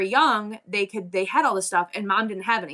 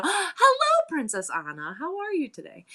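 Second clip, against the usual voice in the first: high, sing-song imitated sweetness; cutesy princess greeting; 9 semitones higher than usual. Showing how kids get to her, high cutesy greeting.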